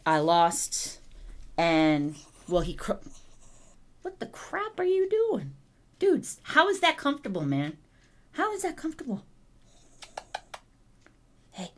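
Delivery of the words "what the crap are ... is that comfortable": higher amused disbelief; surprised. Real, not acted. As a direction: laugh at the odd pose. Surprised at dog.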